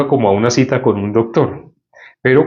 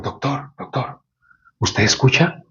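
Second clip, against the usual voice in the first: hushed secret question; 5 dB quieter than usual. Retold or imitated. Patient secretly asking the doctor.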